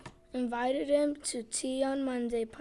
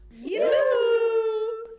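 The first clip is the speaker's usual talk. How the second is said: long, high, loud yoo-hoo; calling him; 10 semitones higher, 13 dB louder than usual. A narrator's reading. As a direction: stretch out a piercing neighbourly call. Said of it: "kept calling him".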